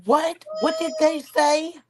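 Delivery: high, startled mock of someone just woken; startled waker. Imitating her waking up instantly from snoring, startled. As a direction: jolt awake high-pitched and confused. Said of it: "wake up instantly and she's like".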